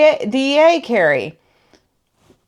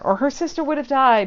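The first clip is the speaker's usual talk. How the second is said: usual speaking voice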